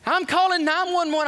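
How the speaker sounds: high, loud, urgent; panicked clerk. Retold or imitated. Desk clerk answering the preacher, high panicked yell. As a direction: blurt it out like the lobby is burning. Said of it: "He says".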